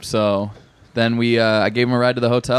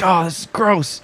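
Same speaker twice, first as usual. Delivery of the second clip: high disgusted mock complaint; imitating disgust; 8 semitones higher than usual. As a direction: spit it out and complain, pitched up. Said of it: "He's like".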